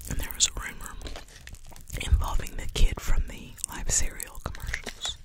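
ordinary talk, nothing marked

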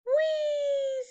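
high gleeful whoop; excited squeal. A narrator's reading. Letter D squealing with glee to E. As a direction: squeal it like a kid racing up. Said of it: "said D".

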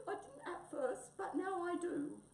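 high, tearful fondness; gulping wombat. Acted. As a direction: confess affection while choking up. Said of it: "gulped Wombat".

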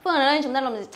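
ordinary talk

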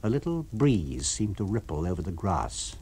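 plain reading voice

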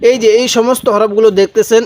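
ordinary delivery

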